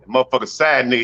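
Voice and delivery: usual speaking voice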